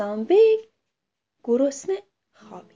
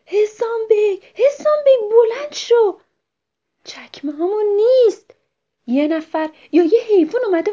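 higher, louder calls to wake a friend; panicked waking shout; 10 semitones higher, 5 dB louder than usual. Acted. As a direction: yell his name across the camp. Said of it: "داد زد".

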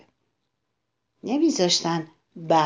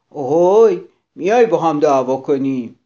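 louder, fast cocky taunt; rude challenge; 4 semitones lower, 6 dB louder than usual. Acted. Grouchy ladybug taunts the wasp, loud and cocky.

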